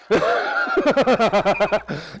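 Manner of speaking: amused laugh at his own story; preacher laughing at the irony; real laugh. Real, not acted. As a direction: laugh out at the punchline.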